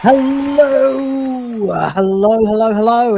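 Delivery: loud bright show opener; excited greeting. Real, not acted. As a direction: greet the stream with energy.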